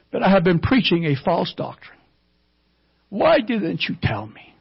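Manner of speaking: high, breaking voice, pleading why again and again; retold crying plea. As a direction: voice cracking with grief, begging for an answer. Kneeling pastor weeping, asking why.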